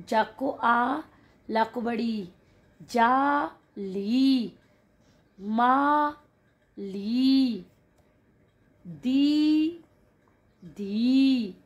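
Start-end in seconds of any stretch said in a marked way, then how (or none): 2.9-11.6 syllables stretched slowly, ली and दी leaned on; drawn-out syllables. Shown as a demonstration. Teacher sounding out syllables of जाली and दीदी for kids. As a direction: spell out each syllable slowly. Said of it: "थोड़ा सा जोर लगाकर बोलना".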